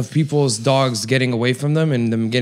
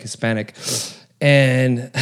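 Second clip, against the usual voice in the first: ordinary talk, nothing marked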